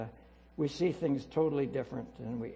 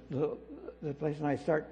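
ordinary talk, nothing marked

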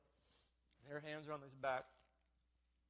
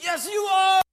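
high, full-force shouted affirmation; loud unison-style yell; crowd shouting; 32 dB louder, slower than usual. Retold or imitated. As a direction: shout it like a whole room cheering someone on. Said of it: "everybody goes".

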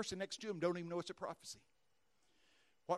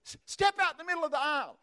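loud and much higher, calling across a hall; 12 semitones higher, 11 dB louder than usual. Retold or imitated. Preacher calling the old lady up in the arena, loud. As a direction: call her up from the stage.

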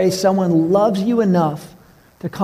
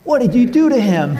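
higher, louder mock alarm, with a laugh; shocked accusation; 7 semitones higher, 4 dB louder than usual. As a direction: play the shocked coworker, laughing. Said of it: "employees are all around like".

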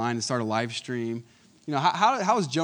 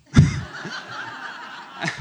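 breathy laugh. Real, not acted. Laughing at son's candy request.

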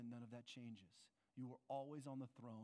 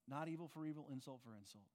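ordinary delivery

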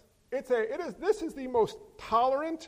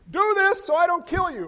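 higher, louder mock menace; mock threat; 8 semitones higher, 7 dB louder than usual. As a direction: ham up a threatening God. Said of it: "So Jesus says".

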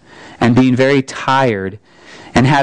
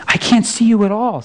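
put-on higher, boyish and uneasy; scared boy voice; 9 semitones higher than usual. A narrator's reading. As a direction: speak as a frightened boy. Frightened boy in the dark.

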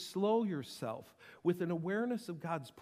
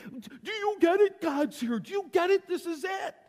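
higher, louder, eager voicing of people getting it; excited exclaiming; 10 semitones higher, 5 dB louder than usual. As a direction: burst out like you just got it. Excited people seeing God.

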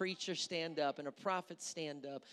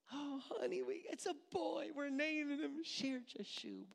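higher, soft, doting parent voice; mock proud parent; 7 semitones higher, 6 dB quieter than usual. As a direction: lightly play a parent announcing the baby. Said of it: "How would you like".

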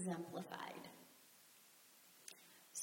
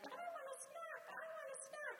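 put-on high, fast whining child begging; a kid begging for a snack at dinner time; whiny child; faster than usual. Mother imitating a child whining for a snack.

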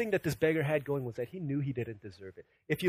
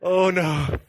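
loud, higher mock-horrified outburst; hammed-up dread, suddenly louder; comic dismay; 4 semitones higher, 8 dB louder than usual. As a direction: act out a dad's loud dismay. Father dismayed at child's cry.

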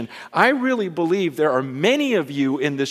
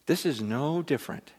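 an intimate whispered-down prayer, much softer; hushed prayer; 3 semitones lower, 8 dB quieter than usual. Retold or imitated. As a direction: pray quietly as if deep underwater. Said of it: "I was praying".

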